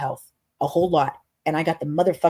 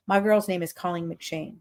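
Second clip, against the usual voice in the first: usual speaking voice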